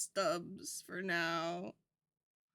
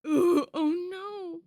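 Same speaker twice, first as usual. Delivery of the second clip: shocked cry; 6 semitones higher, 13 dB louder than usual. Real, not acted. Shocked by frog pictures.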